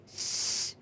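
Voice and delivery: "श-" as a sustained shush; long hushing sh. Shown as a demonstration. Teacher isolating the sound, "श-" as long hush. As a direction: hold "श-" like hushing someone, no vowel. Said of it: "जैसे हम श-, कहते हैं ना".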